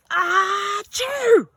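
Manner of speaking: high, loud cartoon sneeze; big sneeze. Humbert the hamster sneezes on the pepper, loud and high.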